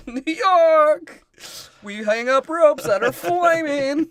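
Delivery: high, drawn-out shout; mock civic boast. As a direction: stretch it out loud like a proud local. Mock civic boast by acted locals.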